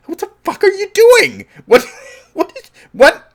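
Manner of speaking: high, loud, laughing outburst; laughing furious yell. Retold or imitated. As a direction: yell in mock disbelief through laughter. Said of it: "he acts the same way".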